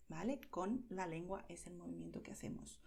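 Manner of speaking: usual speaking voice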